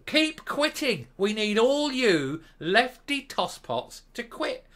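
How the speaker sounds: high slow taunting jeer; slow mocking taunt. Real, not acted. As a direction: keep quitting drawn out high and loud. Mocking rant, slowed.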